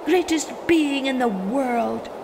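Mole's awed hail. Acted. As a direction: call up to the wind in wonder. Mole hails the wind.

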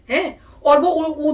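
ordinary talk, nothing marked

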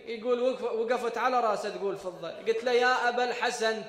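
loud, slow chanted mourning; lamenting recital. Acted. Preacher recites Ali washing Fatima, loud chanted lament. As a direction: chant the washing scene with grief.

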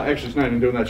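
normal talking voice

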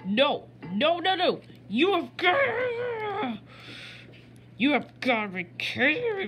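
high, drawn-out wail of no; high, slow, near-crying no; anguished cry. Real, not acted. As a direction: cry out in despair. Despairing cry at game.

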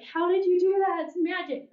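high, astonished near-tearful cry; a high, breathless marvel at the miracle; amazed sobbing. Retold or imitated. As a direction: imitate the woman overwhelmed by sudden relief. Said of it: "She started sobbing".

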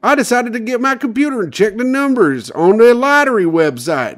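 very high, loud put-on winner voice; lottery winner impression. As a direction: squeal it like the amazed winner. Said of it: "the winner recalled".

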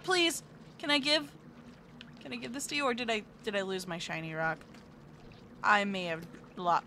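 ordinary talk, nothing marked

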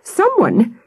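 high, agitated cry; breathless alarm. A narrator's reading. Missus Webb finding the tents wrecked, breathlessly alarmed. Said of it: "she added breathlessly".

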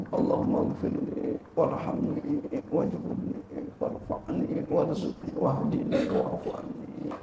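doa dibisikkan pelan; berbisik. Shown as a demonstration. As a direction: bisikan khusyuk, nyaris tanpa suara. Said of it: "bacanya pelan-pelan, baik-baik". (contoh membaca doa sholat sambil berbisik)

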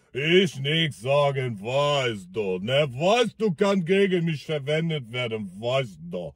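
put-on low, slow, evasive Russian accent; stalling the question in a drunk Russian accent. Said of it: "Mit so einem russischen Akzent". (langsamer, tiefer russischer Akzent, ausweichend)

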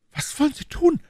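very high, panicked female voice; shrieked question in sudden alarm; alarmed cry. Acted. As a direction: cry out to stop him. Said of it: "rief Madame Bonacieux".

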